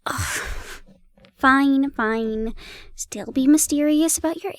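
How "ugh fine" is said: ordinary delivery, nothing marked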